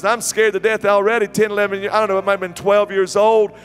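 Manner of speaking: animated preacher storytelling. Real, not acted. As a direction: project the tale with mounting comic alarm.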